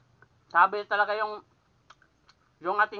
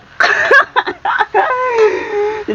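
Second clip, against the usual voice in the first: loud, high laughing shriek; burst of laughter; 12 semitones higher, 11 dB louder than usual. Real, not acted. Frog falls into the water.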